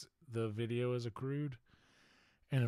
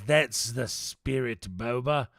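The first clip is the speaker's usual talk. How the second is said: ordinary talk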